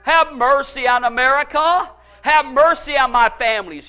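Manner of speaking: ordinary talk, nothing marked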